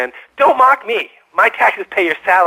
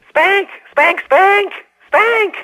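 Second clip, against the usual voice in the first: shouted word; 12 semitones higher, much slower than usual. Acted. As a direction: bark the word at the host like a chant. Caller yelling nonsense on air.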